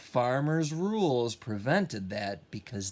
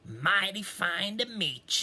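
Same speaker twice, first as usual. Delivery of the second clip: higher, lightly voiced pig; friendly pig; 7 semitones higher than usual. Pig greeting Duck, friendly and higher. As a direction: greet him like a cheerful drummer. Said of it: "said Pig".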